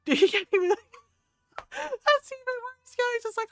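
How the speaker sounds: laughing aloud, voice rising high then fading; real laughter while telling. Real, not acted. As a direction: giggle the words out. Laughing at nephew's logic.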